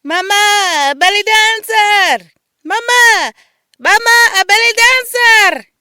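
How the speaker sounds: put-on high, loud, slow child yelling; a young boy shouting for his mother; boy screaming. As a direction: yell for mama from the window.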